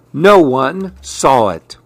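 plain reading voice

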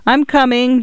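put-on a boy calling across the lawn in despair. A narrator's reading. Boy calling to the nurse across the lawn, despairing call. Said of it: "he called with despair".

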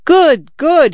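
gleeful cries, pitched high and loud. A narrator's reading. Drunk Ivan greets Simon, crying out in high glee. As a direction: Ivan crowing with delight. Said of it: "he cried in high glee".

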